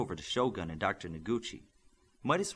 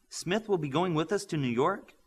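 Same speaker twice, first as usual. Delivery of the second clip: high, startled question; surprised gasp of a question; shocked; 7 semitones higher than usual. A narrator's reading. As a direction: blurt it out in disbelief. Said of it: "Midas said with shock".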